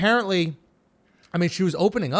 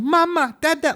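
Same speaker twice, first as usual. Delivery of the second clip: put-on coaxing baby talk; 12 semitones higher, 5 dB louder than usual. Parents coaxing baby over crib.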